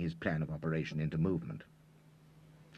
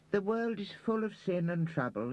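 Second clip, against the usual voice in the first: mock sad; 14 semitones higher than usual. A narrator's reading. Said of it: "shaking his head sadly".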